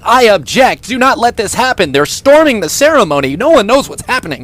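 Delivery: loud angry objection from a crowd; mob shouting. Retold or imitated. Angry men storming the wedding, shouting loudly.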